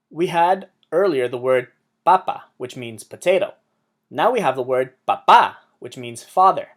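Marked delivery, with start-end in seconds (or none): 2.0-2.4 "papa" said PA-pa, potato; weight on the first pa of "papa"; Spanish "papa", unstressed ending; stress on first syllable. Shown as a demonstration. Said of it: "emphasizes the second to last syllable". 5.1-5.5 "papá" said pa-PA, father; Spanish "papá", weight at the end; stress on last A. Shown as a demonstration. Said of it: "has an emphasis on the last A".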